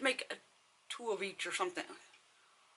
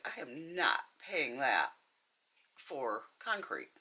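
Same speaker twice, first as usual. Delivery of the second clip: slow, deliberate refusal; retold firm refusal; 3 semitones lower, 3 dB quieter, much slower than usual. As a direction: space the words out for emphasis.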